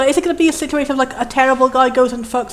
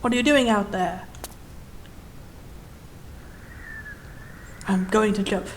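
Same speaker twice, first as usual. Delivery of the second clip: low, quiet jumper; 5 semitones lower, 12 dB quieter than usual. Acted. Jumper on ledge speaks.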